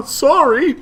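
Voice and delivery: put-on high, loud, bubbly fish girl; excitable cuttlefish voice. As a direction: squeal it out like an overexcited sea creature. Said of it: "I'm working on the voice".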